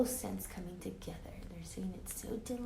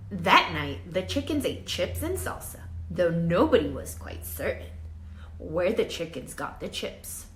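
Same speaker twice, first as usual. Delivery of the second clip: put-on lively read-aloud for children; story narration; 8 dB louder than usual. A narrator's reading. Teacher reading a storybook to her class, lively read-aloud. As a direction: tell it like a picture book to kids. Reads picture book to class.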